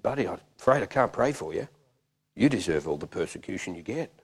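low, soft, understated refusal; quiet rebuke. Retold or imitated. Preacher refuses to pray for man, quiet rebuke. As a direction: deliver the verdict privately and softly.

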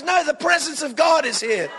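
high, carried-away church mum; imitation of a mother in full spiritual fervour; fervent mum. Imitates fervent mum refusing to stop.

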